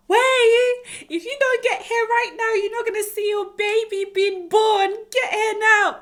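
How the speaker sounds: high, loud panicked yell down the phone; frantic yell. Retold or imitated. Woman in labour yelling at husband on the phone, high frantic shout. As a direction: shout at him to hurry, almost screaming.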